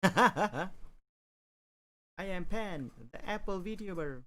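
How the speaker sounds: high, bright cute VTuber intro; cute anime streamer. Pretend. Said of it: "That sounds like a VTuber".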